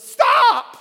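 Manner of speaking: desperate warning shout; yelling stop. Retold or imitated. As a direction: yell it like a car is about to crash. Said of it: "I started yelling".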